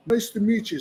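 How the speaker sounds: high-pitched imitation of friend's impaired speech; imitated impaired speech. Friend in wheelchair greeting the singer, imitated impaired speech. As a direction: raise the pitch, mimicking an impaired speaker. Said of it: "he's got brain damage".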